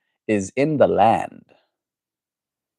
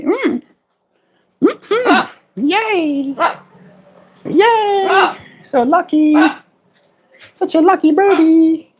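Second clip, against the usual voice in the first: squeaky high coo; 3 dB louder, much slower than usual. Real, not acted. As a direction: squeal praise at the pet.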